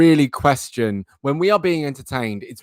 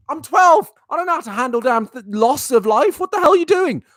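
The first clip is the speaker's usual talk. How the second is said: squeaky, much higher mock twelve-year-old meltdown; mock outraged kid; 12 semitones higher than usual. Podcaster mocking his younger self, higher pitched outraged kid. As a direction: play a betrayed kid gamer losing it.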